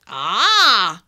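sudden loud exclamation; a sharp, swooping "Ah!"; sharply. A narrator's reading. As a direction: pounce on the realisation. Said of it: "declared the leprechaun sharply".

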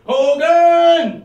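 very loud high "Hogan!"; German colonel bellow. Acted. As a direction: bark like Colonel Klink. Klink shouting catchphrase.

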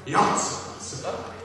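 normal talking voice

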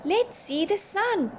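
high, loud eager calls; high-pitched burst of excitement; excited shouting. A narrator's reading. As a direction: shout it out, urging everyone along. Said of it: "Poppy shouted".

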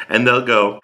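normal talking voice

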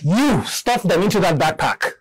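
effortful push. Acted. Acts cramming people into the backpack.

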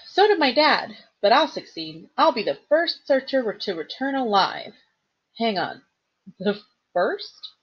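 a hint of a young satyr reverently recounting legend; lightly voiced earnest satyr. Grover tells Percy the legend of Pan, reverent.

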